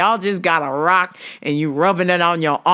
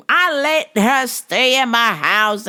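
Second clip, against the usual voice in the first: normal talking voice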